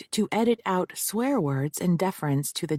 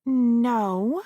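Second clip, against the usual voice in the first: a drawn-out Southern California No, a touch louder; Southern California drawl; 5 semitones higher, 4 dB louder than usual. Acted. Witness answers the prosecutor with a drawn-out Southern California drawl. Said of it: "drew the word out in her Southern California drawl".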